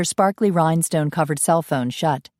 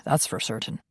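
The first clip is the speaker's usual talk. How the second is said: much lower and softer, unvoiced aside; low Scot aside; 6 semitones lower, 8 dB quieter than usual. Ian muttering to himself, lower and soft. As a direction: say it under your breath.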